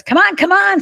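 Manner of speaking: high, loud impatient call; imitating dad hurrying everyone out the door; father calling the family to hurry up, high and loud. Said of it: "my father again goes".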